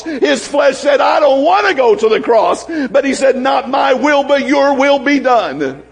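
building into a fervent shouted sermon climax; preaching at full force. Acted. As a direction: preach it with rising fire.

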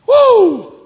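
high ringing woo shout; joyful woo holler. Real, not acted. Preacher celebrating the healing, loud woo. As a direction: let out a big happy woo.

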